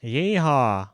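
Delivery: put-on a lighter cowboy yeehaw in a Western accent. Reminiscing about the game, light Western accent. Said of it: "still kept talking in accent".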